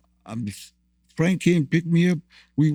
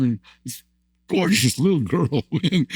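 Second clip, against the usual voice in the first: normal talking voice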